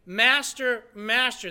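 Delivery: loud, urgent double call; emphatic cry. Retold or imitated. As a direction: call out the name twice, emphatically. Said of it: "That's an emphatic".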